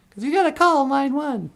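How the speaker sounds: put-on sweet falsetto Natasha calling her puppet boyfriend. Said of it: "you'd hear Natasha go".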